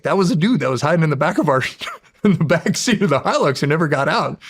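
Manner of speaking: high, animated retelling broken by a laugh; laughing through a retold quote; amused reveal. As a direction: tell the punchline while cracking up. Funny reveal, laughs while retelling.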